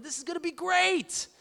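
high-pitched, eager exclamation; retold burst of happy anticipation; excited anticipation. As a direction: act out being thrilled. Acting out his eager thought.

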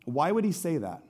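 ordinary talk, nothing marked

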